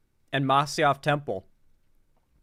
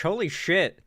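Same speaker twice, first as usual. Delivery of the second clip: ordinary talk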